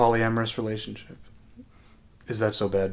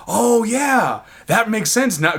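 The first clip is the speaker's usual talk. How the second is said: high-pitched dawning realization; retold realization; 9 semitones higher, slower than usual. Retelling a friend's accent explanation, sudden realization. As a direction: act out the penny dropping, pitch up. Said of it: "I'm like".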